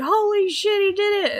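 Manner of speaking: high, loud mock amazement; amazed squid bro. Squid bro amazed at the archer's coin trick, high excited cheer. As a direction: cheer it like you can't believe it. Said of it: "this one's like".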